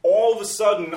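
ordinary talk, nothing marked

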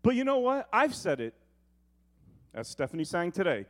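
ordinary talk

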